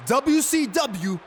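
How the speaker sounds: slow, drawn-out proclamation to the arena; drawn-out announcement. Acted. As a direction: stretch out every word for the crowd. Wrestler unveils big show name.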